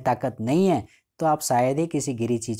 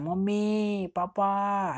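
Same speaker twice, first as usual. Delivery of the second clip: put-on child calling out; 7 semitones higher, much slower than usual. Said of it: "एकदम ज़ोर से चिल्लाता है".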